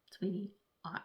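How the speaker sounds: soft, breathy aside to a partner; whispered plea. Retold or imitated. Client asks her partner for time alone, whispered. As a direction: hush it like you're asking gently for a break. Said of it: "And you're like".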